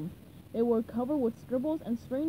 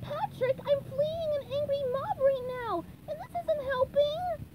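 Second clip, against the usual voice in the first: very high, desperate pleading; high-pitched cartoon plea; SpongeBob; 14 semitones higher than usual. A narrator's reading. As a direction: plead urgently in a squeaky register. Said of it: "SpongeBob pleaded with his buddy".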